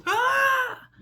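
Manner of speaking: very high, loud "Ah!" scream; mock freaked-out scream. Showing how freaky ticks are, mock freaked-out scream. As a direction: let out a sudden high-pitched mock shriek. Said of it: "I mean, like, freaky".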